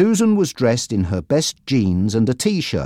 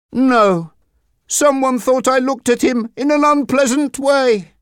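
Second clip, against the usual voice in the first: a hint of a Spanish accent, higher; lightly voiced Mario, Spanish accent; 14 semitones higher than usual. A narrator's reading. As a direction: explain it like a proud young rider, lightly accented. Mario answers.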